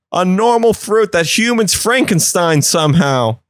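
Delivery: offended little pickle complaining; indignant pickle. Retold or imitated. Pickle complaining about lint, a bit high and whiny. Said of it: "he's like".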